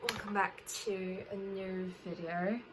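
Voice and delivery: slipping lightly into an Australian accent; a hint of unintended Australian twang; accidental Australian accent. Real, not acted. Vlogger greeting viewers at the start, accidental Australian accent. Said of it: "was that Australian accent".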